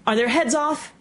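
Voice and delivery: shouted demand, pitched well up. A narrator's reading. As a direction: call out the question imperiously. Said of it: "shouted the Queen".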